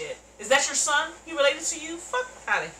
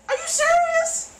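high, slow, loud disbelief; drawn-out outraged question; stunned outrage; 8 dB louder, slower than usual. Real, not acted. Viewer to the principal, high slow disbelief.

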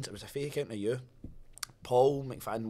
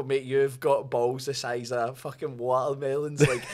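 laughing; 6 dB louder, slower than usual. Real, not acted. Laughing while telling scammer off.

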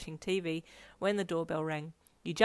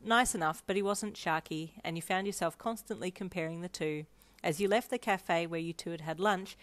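plain reading voice

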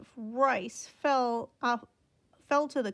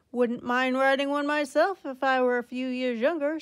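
hearty uncle; 3 semitones higher, 7 dB louder than usual. A narrator's reading. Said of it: "said Uncle Lester".

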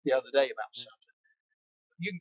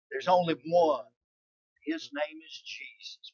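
normal talking voice

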